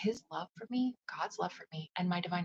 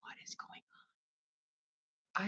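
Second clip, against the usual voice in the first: stunned whisper; 8 dB quieter than usual. Retold or imitated. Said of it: "And I'm like".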